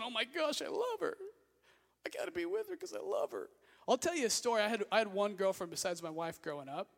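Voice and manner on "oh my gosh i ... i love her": high, swooning mock crush voice; mock lovestruck teen. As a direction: gush like a lovesick teenager. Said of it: "the guys and the girls, when".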